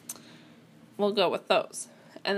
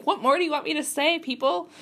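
higher, quick, mock-exasperated protest; exasperated retort; 7 semitones higher than usual. Exasperated character to the people yelling at them, mock fed up. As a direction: throw it out fast and high, playfully fed up.

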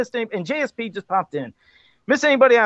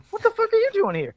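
surprised; 13 semitones higher than usual. Real, not acted. Surprised to see James in chat.

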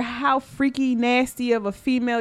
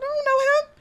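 high, innocent denial; high pretend-innocent I don't know him; mock denial. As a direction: play dumb in a high voice. Acting her denial to friends.

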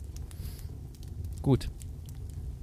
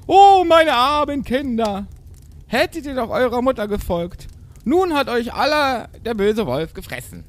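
high, drawn-out theatrical wail; mock sobbing mother; 14 semitones higher, 7 dB louder, slower than usual. A narrator's reading. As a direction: lament loudly like a hammed-up goat mother. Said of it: "Sie schluchzte und weinte". (übertrieben klagende Geißenmutter)

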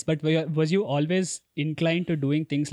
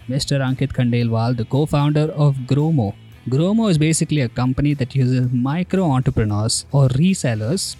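loud, upbeat show host announcement; podcast intro read; 11 dB louder than usual. Acted. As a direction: announce the episode like a presenter. Host reads show intro over music.

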